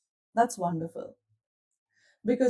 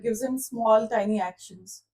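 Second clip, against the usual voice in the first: ordinary talk, nothing marked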